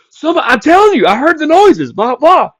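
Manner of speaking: high, loud, urgent insistence; insisting. Retold or imitated. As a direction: shout it up high, desperate to be believed. Insisting he heard noises.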